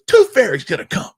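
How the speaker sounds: put-on high, loud excited child voice; seven-year-old daughter. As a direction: call it out like a thrilled little girl. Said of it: "she goes".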